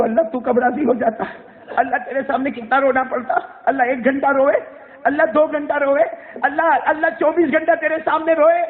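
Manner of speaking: fervent preacher pleading; impassioned preaching. Acted. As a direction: press the point hard to the listeners. Preacher voices plea and reply.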